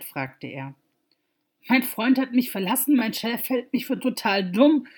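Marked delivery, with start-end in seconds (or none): 1.7-4.9 tearful. Acted. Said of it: "Neue Tränen rannen über ihre Wangen".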